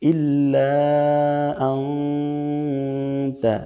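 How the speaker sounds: slow, drawn-out Arabic chant; slow Quranic recitation. Acted. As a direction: recite the dua with long held vowels. Continues slow prayer recitation.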